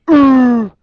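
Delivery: loud, high "arr" yell; pirate scream. Acted. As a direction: scream as if flung backwards. Second character flung back.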